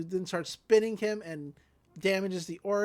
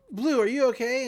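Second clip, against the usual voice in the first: mock yell; 5 semitones higher, 5 dB louder than usual. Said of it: "Don't scream in my ear".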